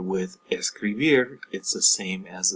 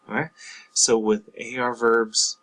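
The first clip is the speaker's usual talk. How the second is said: ordinary talk, nothing marked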